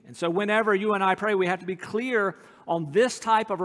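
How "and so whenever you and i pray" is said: ordinary talk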